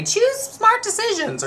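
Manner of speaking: high mocking voice, slow; mocking misquote. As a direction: send up the wrong version of the saying. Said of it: "Some people say".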